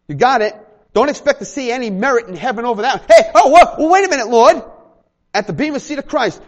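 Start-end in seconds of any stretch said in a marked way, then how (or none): 3.1-4.6 startled believer pleading with God, high and loud; mock protest. Churchgoer protesting to the Lord, hammed-up and loud.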